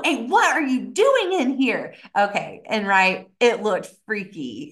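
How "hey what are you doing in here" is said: raised-pitch mock scolding; imitating angry man. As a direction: demand it like an indignant intruder. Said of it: "his anger and his, like, angst".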